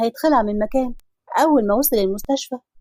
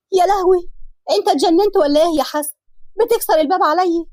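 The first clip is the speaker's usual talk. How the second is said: scream of fright; 6 semitones higher than usual. Acted. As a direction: shriek as he smashes her door in. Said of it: "صرخت وهي بتقول".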